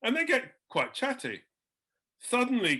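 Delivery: ordinary talk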